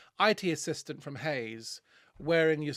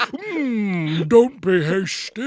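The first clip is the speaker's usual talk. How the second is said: Treebeard; 3 semitones higher, 9 dB louder, much slower than usual. Retold or imitated. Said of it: "I sound like Treebeard".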